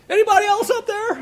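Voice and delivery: very high, loud yell upward, half joking; desperate yell. Retold or imitated. Jack on the cliff, refusing God's advice, yells for anyone else. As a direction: shout it up the canyon like a punchline. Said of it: "finally Jack yells".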